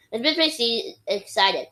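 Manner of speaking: ordinary delivery, nothing marked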